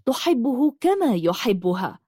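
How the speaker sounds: plain reading voice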